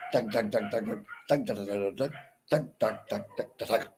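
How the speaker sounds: soft tapped-out metre in nonsense syllables; rhythmic tuk-tuk beats. Shown as a demonstration. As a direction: tick out the beat with quick tuks. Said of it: "The way in which you read".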